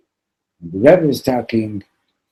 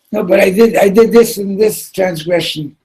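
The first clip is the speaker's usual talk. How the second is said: much higher and louder, pleading; desperate confession; 11 semitones higher, 9 dB louder than usual. Retold or imitated. Preacher confessing to the axe man, high frantic pleading. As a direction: plead your sins in a panic.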